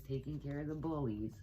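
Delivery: usual speaking voice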